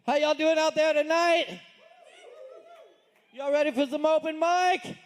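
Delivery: open-mic emcee working the crowd; hyping the crowd. Real, not acted. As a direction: call out to the room, stretching the last word. Host hypes up open mic crowd.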